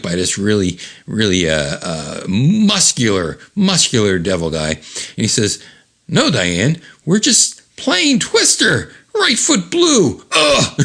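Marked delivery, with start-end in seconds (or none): 6.1-10.8 high, slow fake-innocent excuse; high drawn-out innocent voice; mock innocent. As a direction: pretend it's just a game.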